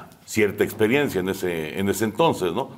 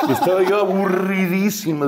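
laughing; 9 semitones higher than usual. Real, not acted. Laughing while telling.

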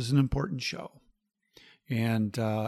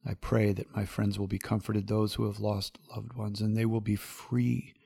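intimate closing prayer. Acted. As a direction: pray gently for a grieving friend.

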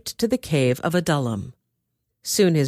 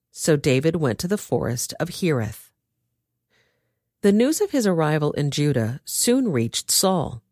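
plain reading voice

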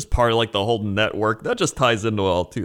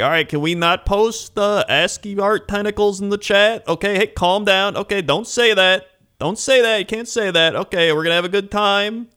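high, loud voice over an unruly class; imitated teacher losing control of the room; harried substitute teacher; 10 semitones higher, 4 dB louder than usual. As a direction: plead for order like a substitute teacher. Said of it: "feels like you're a substitute teacher".

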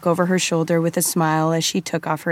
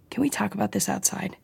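softer, hesitant plea to step outside; hushed question; 3 semitones higher, 7 dB quieter than usual. Acted. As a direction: drop your voice, unsure. Cosima hesitant, lowering voice.

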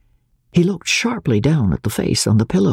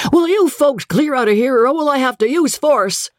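high-pitched blustering mock anger; blustery pretend rage; simulating fury; 12 semitones higher than usual. A narrator's reading. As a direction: threaten with put-on fury, voice pitched up. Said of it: "simulating fury".

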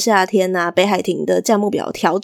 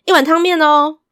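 higher and louder call to the kitchen; 7 semitones higher, 4 dB louder than usual. Acted. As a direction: shout the order back cheerfully. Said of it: "跟厨房大喊说". (朝厨房大声喊)

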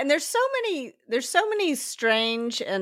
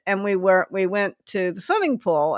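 ordinary delivery

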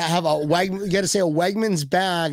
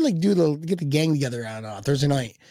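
ordinary talk

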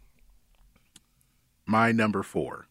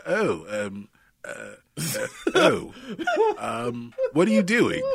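a half-hammed posh British fluster, voice jumping higher; terrible British accent; 5 semitones higher, slower than usual. Joking about British films, a bad British accent stammering in surprise. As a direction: play the stammering Englishman bursting through a door, badly. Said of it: "I'm doing a terrible British accent".

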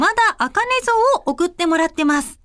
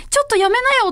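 mock scolding call; 8 semitones higher, much faster than usual. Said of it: "その声にびっくりして".

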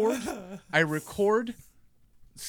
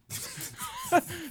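big laugh. Real, not acted. Laughing at his own prank.

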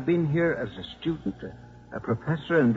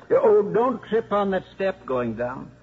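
louder, higher and slower call down the steps; calling after them; 6 semitones higher, 4 dB louder than usual. Acted. Old priest calling a warning after the departing visitors, raised and slow. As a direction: call out a warning as they leave.

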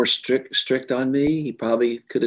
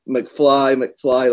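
lightly voiced movie call of McFly; a light nod to the Back to the Future line; mock Back to the Future; 3 semitones higher, 5 dB louder than usual. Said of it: "I kinda did the Back to the Future thing".

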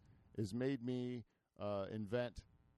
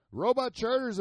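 announcer call; 11 semitones higher, 10 dB louder than usual. Real, not acted. Introducing guest to audience.

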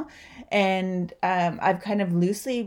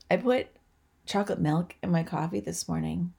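ordinary talk, nothing marked

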